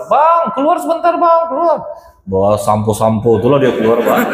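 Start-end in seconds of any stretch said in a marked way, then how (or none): 0.1-1.8 high-pitched wife's voice calling from outside; wife shouting for her husband, high and urgent. Retold or imitated. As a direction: call him out of the bathroom in a much higher voice. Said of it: "teriak istrinya di luar".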